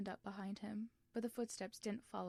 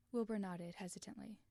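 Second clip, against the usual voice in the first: usual speaking voice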